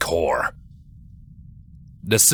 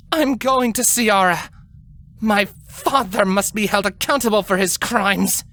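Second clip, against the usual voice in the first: a lightly voiced, higher determined younger sister; stubborn young princess; 12 semitones higher than usual. A narrator's reading. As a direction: insist on going, jaw set. Twilight insists on leaving.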